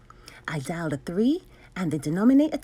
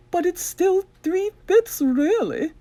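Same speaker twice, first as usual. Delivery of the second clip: slightly higher, louder, prim teacherly lady; a lady mathematician explaining, a touch higher; Madame's voice; 10 semitones higher, 4 dB louder, slower than usual. Acted. Madame explains the fractions, lightly voiced lady.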